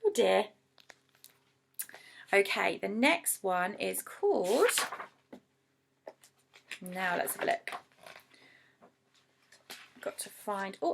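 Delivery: usual speaking voice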